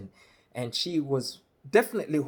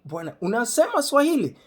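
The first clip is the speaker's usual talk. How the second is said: high, loud amazed exclamation; astonished question; 8 semitones higher, 5 dB louder, faster than usual. Retold or imitated. To the cashier who speaks Swahili, loud and astonished. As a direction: blurt it out in amazed disbelief, pitched high.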